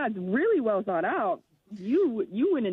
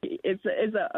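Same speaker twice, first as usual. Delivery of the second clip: usual speaking voice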